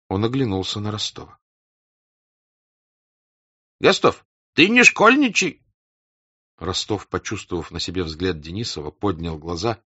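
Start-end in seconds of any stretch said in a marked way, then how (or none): 3.8-5.6 high, loud scolding; stern rebuke. Acted. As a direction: snap at him to stop fooling. Denisov scolds Rostov.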